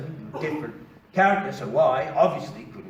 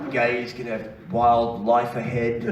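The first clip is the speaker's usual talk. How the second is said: slow mock announcement; 2 semitones lower, slower than usual. Mock sign names, drawn out.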